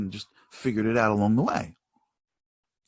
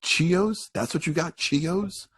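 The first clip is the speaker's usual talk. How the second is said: higher, incredulous questions; mock disappointed mom; 7 semitones higher, much slower than usual. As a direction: act out an appalled mother's disbelief. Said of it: "mom's not ... be like".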